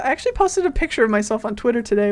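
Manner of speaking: normal talking voice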